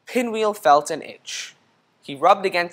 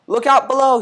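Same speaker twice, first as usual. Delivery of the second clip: high and loud warning; shouted; 8 semitones higher, 7 dB louder than usual. A narrator's reading. Squirrel jumping into leaves, shouted warning. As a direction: call out while jumping. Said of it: "he shouted".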